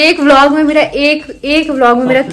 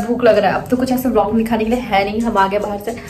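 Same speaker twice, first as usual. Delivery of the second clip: ordinary talk, nothing marked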